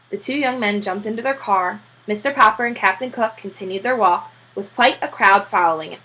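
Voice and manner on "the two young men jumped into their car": usual speaking voice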